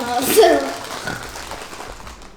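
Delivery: snorting pig-like laugh; piggy voice. Real, not acted. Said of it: "My piggy voice".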